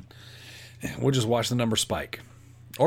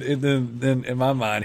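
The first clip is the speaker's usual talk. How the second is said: usual speaking voice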